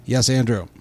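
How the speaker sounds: ordinary delivery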